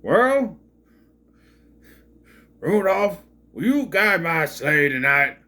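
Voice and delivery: big loud cartoon husband voice; Fred Flintstone. Reviewer spoofing Santa asking Rudolph, loud cartoon impression. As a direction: boom it out like a cartoon caveman. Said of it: "he sounds like Fred Flintstone".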